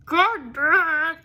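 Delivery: high, slow, strained curse; hammed-up exasperated outburst; frustrated. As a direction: growl it out in mock rage. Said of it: "was frustrated on his behalf".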